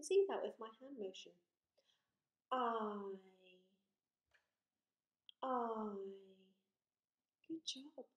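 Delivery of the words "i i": I drawn out, ah gliding to ee; long vowel I. Shown as a demonstration. Teacher shows the changing mouth, I stretched out. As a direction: hold the letter name I long. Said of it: "This long vowel sound also changes".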